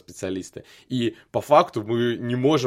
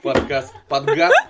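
laughing; 6 semitones higher, 9 dB louder, slower than usual. Real, not acted. Laughing while teasing the take.

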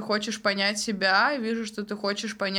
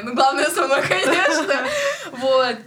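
laughing; 6 semitones higher, 6 dB louder than usual. Real, not acted. Jokes she comes first.

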